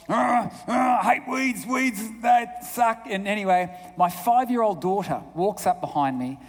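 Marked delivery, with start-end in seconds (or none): 0.1-3.1 higher, groaning grump with drawn-out words; grouchy grumbling. Retold or imitated. Grouchy psychologist grumbling to himself in the garden, exasperated. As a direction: act out a man who is sick of weeding. Grumpy man acted weeding in a story.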